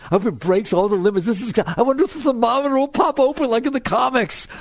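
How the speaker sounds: playfully thrilled, pitch climbing; building gleeful wonder; mock excitement. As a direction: get carried away with comic glee. Acts out gleeful welcome of heat.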